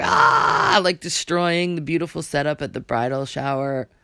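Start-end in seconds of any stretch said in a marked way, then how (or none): 0.0-0.8 high shrill-free mock shriek, loud; cartoon meltdown yell, much higher and louder; mock meltdown scream. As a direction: let out a hammed-up scream. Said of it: "She's like".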